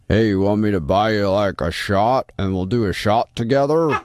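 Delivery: hot pickup voice. Acted. Host imitating a guy at a bar, hammed-up hot voice. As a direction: put on a cheesy barroom seduction voice. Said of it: "not even in that voice? That's a really hot voice".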